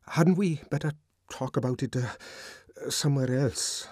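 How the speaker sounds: halting, awkward, stumbling over the words; uneasy stammer with a hesitant uh. Acted. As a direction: stammer it out, embarrassed. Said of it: "he stammered".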